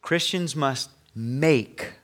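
Christians must make, drawn out very slowly; slow emphasis. Shown as a demonstration. Pastor emphasizing the duty to the congregation, slowly. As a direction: stretch each word to emphasize must. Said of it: "I want to emphasize this word".

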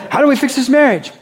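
high, fast mock worried client; counselee asking. Pastor mimics a counseling client, high and hurried. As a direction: play the stuck spouse asking for help. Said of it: "You come for me for counseling".

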